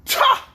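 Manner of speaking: sharp, high spell syllable; spell word. A narrator's reading. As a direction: snap it out like a magic word. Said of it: "and said".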